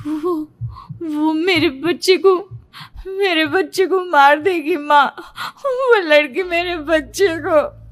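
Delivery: high, halting, tearful plea; slow, sobbing fear after a nightmare; panicked, tearful. A narrator's reading. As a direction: stammer it out to mother, still terrified. Daughter dazed, panicking after nightmare.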